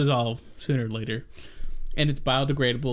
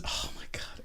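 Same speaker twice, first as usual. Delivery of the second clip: breathy exasperated whisper; 12 dB quieter than usual. Real, not acted. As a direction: whisper it under your breath. Exasperated aside.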